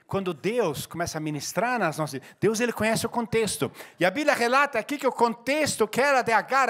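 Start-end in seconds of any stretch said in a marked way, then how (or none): none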